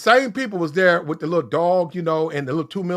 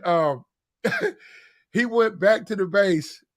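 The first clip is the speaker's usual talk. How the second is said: ordinary talk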